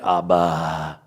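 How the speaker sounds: "Abba" with a full second syllable, "Ab-ba"; sounded out. Shown as a demonstration. Teacher showing how a Hey sounds, "Abba" drawn out. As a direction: carry "Abba" on past the B into "ba". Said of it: "now I'm giving you an idea what the Hey really sounds like".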